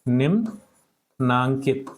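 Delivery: निम्नांकित stretched out very slowly; slow dictation. Shown as a demonstration. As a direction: drag it out for copying. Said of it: "क्या बन जाएगा इसका?". (धीरे-धीरे लिखवाना)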